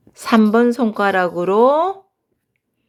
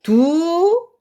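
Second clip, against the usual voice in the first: 두 sung smooth and held; connected; 6 semitones higher than usual. Shown as a demonstration. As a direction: carry 두 over into the next note. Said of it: "미음이 없을 때는 연결을 한다".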